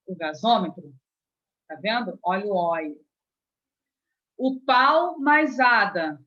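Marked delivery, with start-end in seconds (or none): 4.4-6.2 "O pau mais ada" very slow and louder, each part separated; parts said apart. Shown as a demonstration. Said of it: "ficou estranho". (separe bem "pau mais ada", devagar e mais alto)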